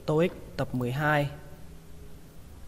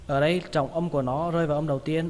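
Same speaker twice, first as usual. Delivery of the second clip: ordinary talk, nothing marked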